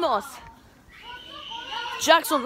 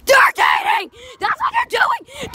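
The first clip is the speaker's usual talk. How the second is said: excited shout; 7 semitones higher than usual. Real, not acted. Excitedly telling on couple.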